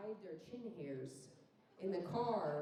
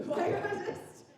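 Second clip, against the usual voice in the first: laughing; 12 semitones higher, 8 dB louder, slower than usual. Real, not acted. Laughing at own joke.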